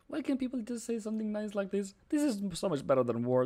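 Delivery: high, gushing and touched; gushing delight. Real, not acted. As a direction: melt at the kind message. Moved by kind chat message.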